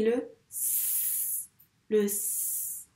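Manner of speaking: S as a long "sss" hiss; isolated S sound. Shown as a demonstration. Teacher breaking a syllable into sounds, drawn out. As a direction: hold the consonant S alone. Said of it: "qu'est-ce qu'on entend comme son".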